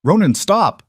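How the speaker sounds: high, loud desperate shout. Acted. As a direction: scream his name to stop him. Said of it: "she shouted".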